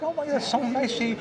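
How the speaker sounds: grateful lady. Retold or imitated. Cashier lady thanks him.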